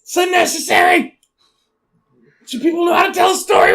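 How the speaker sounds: loud, high, fast exasperated rant; shouting at people who tell stories badly. Real, not acted. Ranting loudly to friends about redundant phrases.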